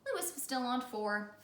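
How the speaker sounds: ordinary delivery, nothing marked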